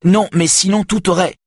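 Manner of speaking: heated interruption; angry. A narrator's reading. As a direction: snap back before stopping dead. Saga flares up again, cut off.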